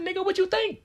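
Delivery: high-pitched indignant comeback; indignant retort. Retold or imitated. Storyteller acts out answering the cop about his sandal, indignant. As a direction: fire back in a squeaky, exasperated voice. Acting out snapping back at the cop.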